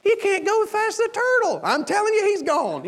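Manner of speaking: high-pitched comic panic, re-enacted; hammed-up exasperated exclaiming; frantic insistence. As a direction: protest in an exaggerated high voice. Voices frantic staff over the escape.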